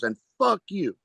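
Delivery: high-pitched mock outburst; heckler's parting yell. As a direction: imitate him turning his outburst on his partner. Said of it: "looks at her and goes".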